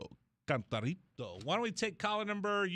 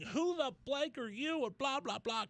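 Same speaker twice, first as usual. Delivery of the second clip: mock screaming woman; 8 semitones higher, 3 dB quieter than usual. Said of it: "she starts screaming".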